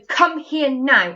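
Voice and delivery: loud, slow, bossy order; bossy command. Shown as a demonstration. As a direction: snap it out like a strict teacher. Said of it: "I'm being bossy and saying".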